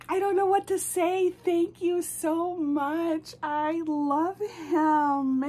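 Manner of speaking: high, emphatic, slowing gush; thrilled, drawn-out gratitude; overwhelmed gushing. Real, not acted. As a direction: gush thanks, stretching each word. Overcome thanks for surprise gift.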